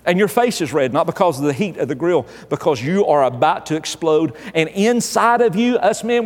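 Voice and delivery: normal talking voice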